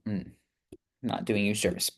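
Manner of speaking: usual speaking voice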